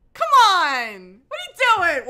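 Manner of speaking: high, loud comic exasperation; exasperated shouting. Real, not acted. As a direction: yell in disbelief at a character. Exasperated at the book character.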